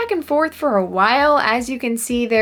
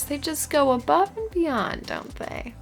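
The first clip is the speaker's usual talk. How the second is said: high, softer sarcastic praise; 6 semitones higher, 5 dB quieter than usual. Real, not acted. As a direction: gush with fake admiration, slowing near the end. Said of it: "I'm being so sarcastic".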